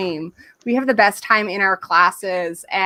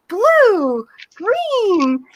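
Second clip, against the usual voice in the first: high, loud, excited word calling; excited teacher; 10 semitones higher, 4 dB louder than usual. Shown as a demonstration. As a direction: call out colours to thrilled toddlers. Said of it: "get really excited with the little kids".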